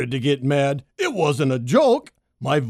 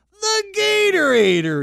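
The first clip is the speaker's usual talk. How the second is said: hissing snake; 4 dB louder than usual. A narrator's reading. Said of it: "he hissed it again".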